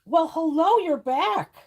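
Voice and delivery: high delighted hello to the cat; cat greeting. Real, not acted. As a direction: greet the returning pet brightly.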